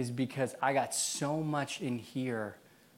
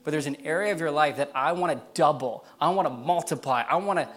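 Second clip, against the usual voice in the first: impassioned preaching; 3 semitones higher, 6 dB louder than usual. Acted. Preacher passing God's call to the community, impassioned preaching. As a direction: press each phrase on the congregation with force.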